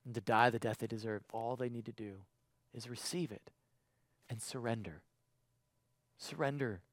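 hushed sincere announcement. Acted. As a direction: gently tell them of the king. Voicing gospel message softly.

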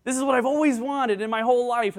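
put-on high, elated child's exclamation; kids on Christmas morning. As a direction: squeal it like a kid unwrapping presents. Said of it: "It's my kids opening up Christmas presents".

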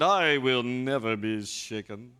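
slow, drawn-out boast; acted boast. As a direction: stretch it out as a self-assured vow. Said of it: "that's how he said it too".

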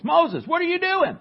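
high, loud, incredulous sarcasm; mock-outraged sarcastic questioning. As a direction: challenge the leader with hammed-up disbelief, rising at the end. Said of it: "You can hear the sarcasm in their voice".

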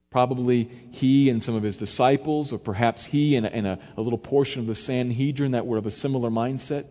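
usual speaking voice

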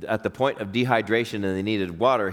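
normal talking voice

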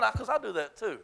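usual speaking voice

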